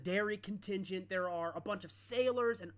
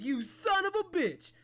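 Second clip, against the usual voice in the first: much higher, much louder mock insult; joyful shout; 12 semitones higher, 9 dB louder than usual. King play-fighting his old friend, joyful shout. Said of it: "you see Amathar goes".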